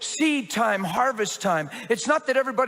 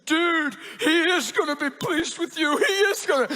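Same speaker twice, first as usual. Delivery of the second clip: laughing excited dude; 9 semitones higher than usual. Retold or imitated. Friend answers the pastor, laughing and high-pitched. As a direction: burst out laughing as you speak. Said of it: "And he said".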